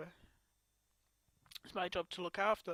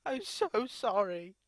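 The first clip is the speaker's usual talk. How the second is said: loud sobbing apology; 3 semitones higher, 11 dB louder than usual. Real, not acted. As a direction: grief spilling over, very loud.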